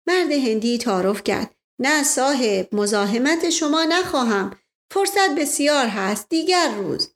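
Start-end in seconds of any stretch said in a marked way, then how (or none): 1.8-7.1 put-on Indian-accented Persian. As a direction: play the polite Indian sardar, a touch of Indian accent. Indian man declines politely.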